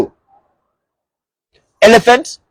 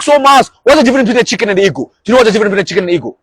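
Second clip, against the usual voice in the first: rapid mock question; 3 semitones higher, much faster than usual. Quick mock audience question.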